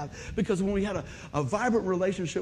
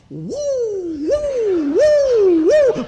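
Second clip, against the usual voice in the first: whoops climbing higher and louder; rising whoops; 13 semitones higher, 10 dB louder, much slower than usual. Retold or imitated. Imitating his tooth fillings buzzing from the fence, climbing high loud whoops. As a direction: let each woo climb like the fillings are buzzing. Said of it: "every one of those bad boys were just going".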